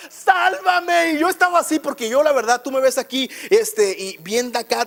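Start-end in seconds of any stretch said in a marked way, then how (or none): 0.1-1.1 grito de auxilio agudo y alargado; Pedro. Retold or imitated. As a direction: imita un grito desesperado, voz muy arriba. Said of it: "Pedro grita". (grito agudo actuado)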